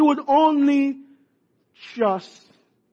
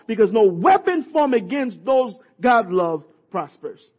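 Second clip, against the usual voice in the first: ordinary talk, nothing marked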